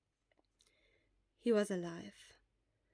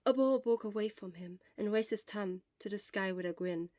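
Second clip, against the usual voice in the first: plain reading voice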